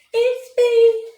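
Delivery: high, loud and bright-toned. Acted. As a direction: call it out up high. Announcing herself playfully.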